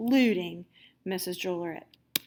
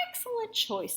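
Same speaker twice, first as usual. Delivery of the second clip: teacher approving; 13 semitones higher, 4 dB quieter than usual. A narrator's reading. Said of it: "said Missus Drollerit".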